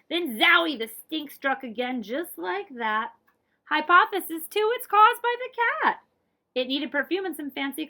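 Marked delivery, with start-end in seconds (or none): 0.1-0.8 loud, high zowie; excited exclamation. A narrator's reading. Exclamation in narration. 3.7-6.0 much higher and louder announcement; excited discovery. A narrator's reading. Excited narration.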